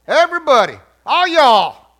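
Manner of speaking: loud, high, emphatic Southern holler; loud Southern call. Pretend. Preacher explaining y'all to the congregation, loud Southern call. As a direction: holler it to the whole room. Mock Southern lesson, called out.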